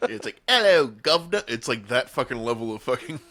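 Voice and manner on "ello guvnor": loud, high mock-British greeting; cartoonishly bad British accent, pitched up. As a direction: ham up a terrible British accent. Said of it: "the worst British accent ever".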